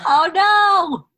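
high, loud, drawn-out dismay; mock horror. Real, not acted. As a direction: wail it in playful horror.

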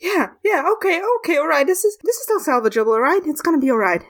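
high-pitched nervous self-soothing; confused, freaking out. Acted. Frog tells himself it's still salvageable, freaking out. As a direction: talk yourself down in a high, anxious voice. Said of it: "he's freaking out".

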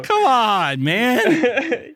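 loud, high mock-exasperated plea; mock indignation. Co-host chiding his friend for the teasing, in mock indignation. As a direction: plead in exaggerated exasperation.

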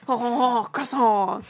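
hammed-up French chuckle into the word; French accent. Shown as a demonstration. Friend demonstrates French trigger words, mock French. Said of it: "That was really good".

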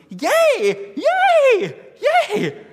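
very high, very loud squealing yays; imitated cheering son. Son running round living room, squealing with joy. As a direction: whoop like a child racing in circles. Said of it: "started running circles around".

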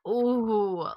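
ordinary delivery

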